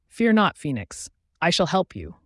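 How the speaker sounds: ordinary delivery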